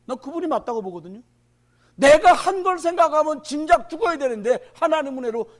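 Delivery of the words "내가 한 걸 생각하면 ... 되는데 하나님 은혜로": preaching at full force. Acted. Pastor warning the congregation about the times, loud and high.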